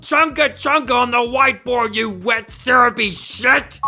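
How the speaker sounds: high, loud furious yell; angry shout. Acted. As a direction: chew out whoever wrote it. Angry rant at crew.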